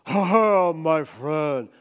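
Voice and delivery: loud hearty laugh then greeting; big booming greeting. Retold or imitated. Preacher imitates friend Moses Uma's loud greeting. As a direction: boom it out like a commanding friend. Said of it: "when he would come in".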